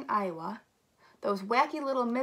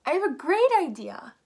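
high, loud burst of inspiration; loud, thrilled announcement; excited exclamation; 6 semitones higher, 7 dB louder than usual. Acted. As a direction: exclaim it with a big swoop. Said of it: "exclaimed Kansas".

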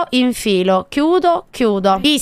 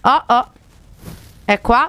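normal talking voice

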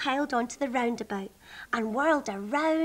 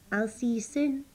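ordinary delivery, nothing marked

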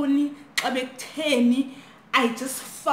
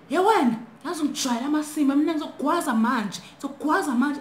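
high-pitched, urgent mock threat; imitated threat; 5 semitones higher, 3 dB louder, faster than usual. Intruder threatening to stab a schoolgirl, mock anger. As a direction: act out an angry intruder's warning.

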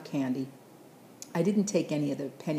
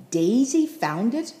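much higher, louder, slow rising question; astonished echo; 8 semitones higher, 8 dB louder, slower than usual. Acted. Grandpa repeats the boy's news in astonished disbelief.

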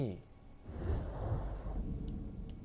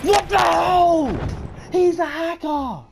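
panicking gamer; 7 semitones higher than usual. Real, not acted. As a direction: bellow it in disbelief. Gamer shot in disbelief.

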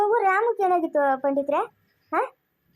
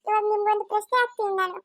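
higher pleading voice of a pandit, urging courage; pandit consoling; 4 semitones higher than usual. Acted. Pandit begging grieving old man not to cry, higher pleading voice. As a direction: plead with him to stop crying, voice lifted.

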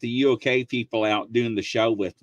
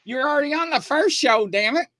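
very high, faster, mock exasperation; exasperated retort. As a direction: roll your eyes and say it high. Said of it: "I'm like".

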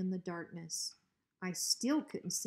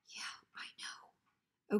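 slow, drawn-out frightened whisper; 5 dB quieter, much slower than usual. Acted. Drover whispering back to Hank in the dark. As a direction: barely breathe it out.

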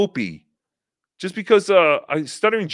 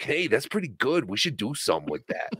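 soft, lightly voiced impressed co-host; quiet mock admiration, sinking lower; Joe Matarese; 3 semitones lower, 8 dB quieter than usual. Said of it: "then Joe would be like".

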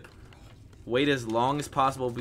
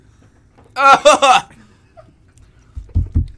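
pained laugh; 11 semitones higher, 13 dB louder than usual. Real, not acted. Pained laugh after chip.

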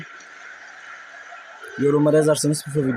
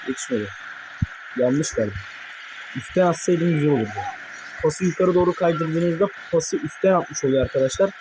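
ordinary delivery

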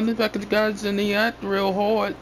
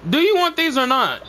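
higher, impatient demand; 6 semitones higher than usual. Real, not acted. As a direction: press him for an answer. Impatient repeat of offer.

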